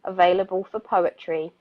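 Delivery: measured, thoughtful poetry reading; poem reading. Acted. Radio host reads a reflective poem aloud, poetry voice. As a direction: read each question slowly like a poem.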